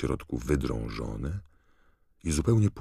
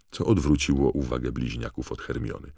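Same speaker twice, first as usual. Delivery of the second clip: usual speaking voice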